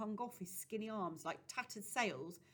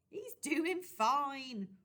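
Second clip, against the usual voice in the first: slow, drawn-out laughing reply; 7 semitones higher, 8 dB louder, much slower than usual. A narrator's reading. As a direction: laugh the words out slowly. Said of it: "Rosie laughed".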